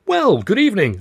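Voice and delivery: bright, high, loud greeting; cried out. Acted. Narrator greets the butler with a high loud cry. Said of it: "I cried".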